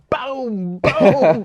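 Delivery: loud, high mock gunshots; booming gunshot imitation; gunshot booms. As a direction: shout the booms like gunfire. Cop shooting gun in movie.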